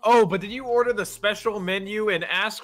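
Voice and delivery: high, slow know-it-all fan; mock Californian fan. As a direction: imitate a gushing burger devotee, drawn out. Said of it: "tell someone, like, from California".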